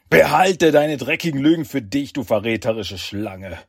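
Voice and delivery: loud, furious villain roar. Acted. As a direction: bellow it with rage. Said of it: "brüllt Saki".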